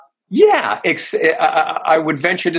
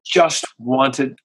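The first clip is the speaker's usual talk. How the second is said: ordinary talk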